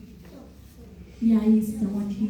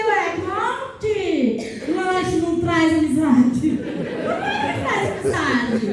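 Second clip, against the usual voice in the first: puzzled; 6 semitones higher, slower than usual. Retold or imitated. Retelling her puzzled child self.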